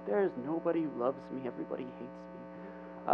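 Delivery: soft, self-pitying mock whine; mock venting. Elijah venting to God in the cave, mock whiny complaint. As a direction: complain it, fading away to a near whisper. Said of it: "like, vents".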